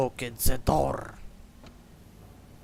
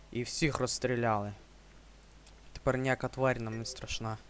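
usual speaking voice

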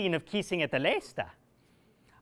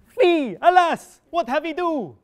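much higher, loud shriek of revulsion; disgusted cry; 11 semitones higher, 11 dB louder than usual. Acted. Absalom shrieking after the wrong kiss. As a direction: recoil with a shrill yelp.

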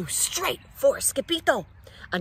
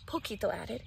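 ordinary delivery, nothing marked

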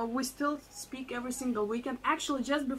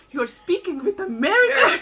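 imitating an excited aunt, high and loud; loud, laughing retold exclamation; 7 semitones higher, 12 dB louder than usual. Aunt amazed at the New Yorker interview, excited. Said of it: "She's like".

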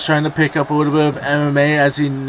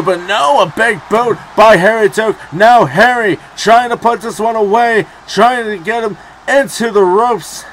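rising excitement; 5 semitones higher, 4 dB louder than usual. Real, not acted. Big boot, match heating up.

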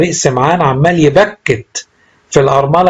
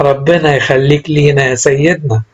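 ordinary delivery

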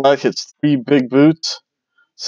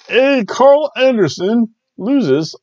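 high, slow drawn-out announcer call; ring announcer call; 11 semitones higher, 4 dB louder, much slower than usual. Acted. Calling the result like an announcer, drawn out.

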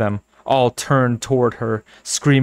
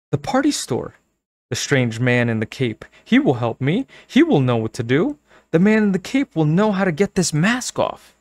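rising hope; 6 semitones higher than usual. A narrator's reading. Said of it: "she thought".

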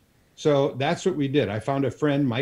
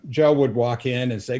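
ordinary delivery

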